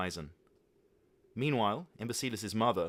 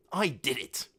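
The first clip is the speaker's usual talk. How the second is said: louder triumphant cry; exclaimed; 5 semitones higher, 5 dB louder, slower than usual. A narrator's reading. Father bursting in to tell his son the news. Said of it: "he exclaimed".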